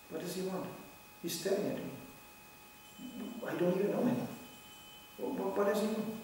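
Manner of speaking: soft, suspicious inner grumbling; mock suspicious mutter. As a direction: mutter it like a wary stranger. Voicing suspicious staring man.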